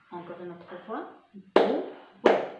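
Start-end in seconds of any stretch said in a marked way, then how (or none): 1.5-2.6 "Brouette" split into loud separate syllables, one clap each; teacher chanting "Brouette" in syllables for children. Shown as a demonstration. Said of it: "Et je tape à la main à chaque syllabe".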